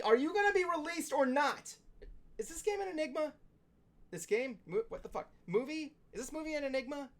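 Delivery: high, whiny exasperated questions; exasperated high rant. Acted. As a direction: beg for answers, fed up. Ranting at delayed Venom release.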